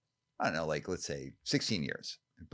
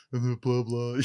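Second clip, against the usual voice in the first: higher, louder dopey blah blah; idiot voice; 6 semitones higher, 5 dB louder, slower than usual. Shown as a demonstration. Host showing the voice he uses for his lame self, dopey. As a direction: play yourself as a clueless idiot. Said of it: "I call it my idiot voice".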